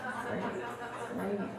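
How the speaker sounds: quiet, laughing sarcasm; sarcastic. Acted. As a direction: undercut it with a dry aside.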